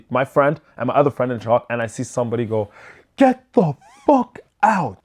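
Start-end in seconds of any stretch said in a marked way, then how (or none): 3.1-5.0 higher, slower command with a hint of anger; a lighter, more controlled take of the yell; a hint of the angry dad repeating the order; stern order.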